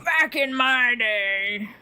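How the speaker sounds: put-on drawn-out old-timer catchphrase; old person. Old people harking back to the past, drawn-out mock old-timer. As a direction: stretch it out slowly like an old person. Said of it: "old people just go".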